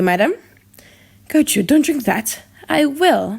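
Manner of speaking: plain reading voice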